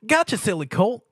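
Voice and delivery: giggly. A narrator's reading. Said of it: "Pinkie giggled".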